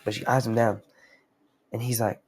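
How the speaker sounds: ordinary talk, nothing marked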